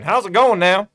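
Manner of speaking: put-on genteel Southern accent, hat-tipping; Southern gentleman accent. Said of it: "I'm giving him this accent".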